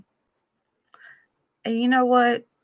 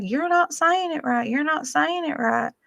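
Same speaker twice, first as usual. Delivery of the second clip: teacher insisting; 7 semitones higher than usual. Retold or imitated. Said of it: "She's like".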